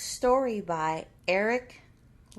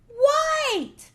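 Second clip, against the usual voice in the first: excited; 13 semitones higher, 4 dB louder than usual. Acted. Answers the color question.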